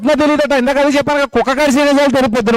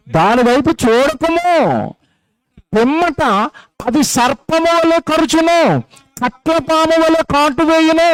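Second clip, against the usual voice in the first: higher, slow solemn reading of the verse; scripture reading; 5 semitones higher, slower than usual. Acted. Preacher reading the Bible warning to his congregation, solemn and slow. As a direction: intone the proverb slowly like scripture.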